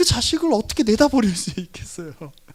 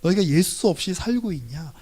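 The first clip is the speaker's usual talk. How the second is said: usual speaking voice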